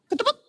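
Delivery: tiny high-pitched mouth effect; quick, high imitated pop of changing shape; squeaked cartoon sound effect; transformation sound.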